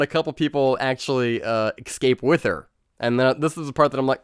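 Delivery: ordinary delivery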